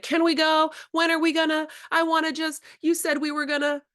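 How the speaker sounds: put-on high, pleading kid voice; a hint of a nagging child; impatient kid. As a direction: whine like a kid who can't wait. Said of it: "So many kids are like".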